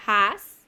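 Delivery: "has" loud, opening on a Spanish J sound. Shown as a demonstration. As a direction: model "has" loudly with the H said like J. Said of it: "se lee como J". ("has" fuerte, con la H como J)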